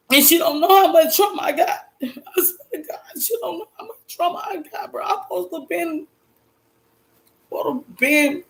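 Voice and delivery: high, choked tearful voice; real crying. Real, not acted. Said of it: "I'm not gonna cry on this".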